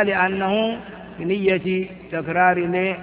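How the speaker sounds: normal talking voice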